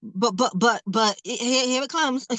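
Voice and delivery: ordinary talk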